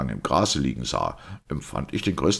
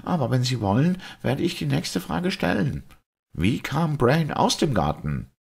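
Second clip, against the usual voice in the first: high, testy doctor voice; sharp; 12 semitones higher than usual. A narrator's reading. Doctor putting the next question to the priest, sharp. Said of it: "meinte Doktor Simon scharf".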